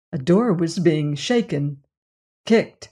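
plain reading voice

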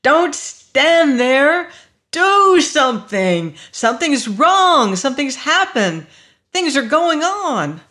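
high, loud frantic screaming; panicked shouting, each cry pushed hard; screamed. A narrator's reading. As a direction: scream at the crowd to act. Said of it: "he screamed".

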